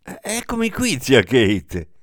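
eager nephew calling out to his aunt; sudden animation. A narrator's reading. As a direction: jump up eagerly to answer. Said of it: "con improvvisa animazione".

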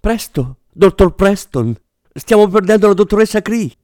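high, urgent fake-nurse delivery; actress reciting lines. A narrator's reading. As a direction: overplay a medical-drama emergency. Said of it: "recitò la sua battuta la finta infermiera". (finta infermiera che recita, voce acuta)

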